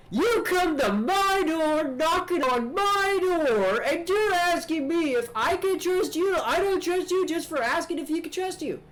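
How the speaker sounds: very high, slow, stretched-out crackpot voice; a paranoid hermit drawling every word way up high; conspiracy nut voice. Acted. Conspiracy nut at his door, very high and slow. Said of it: "he talks like this".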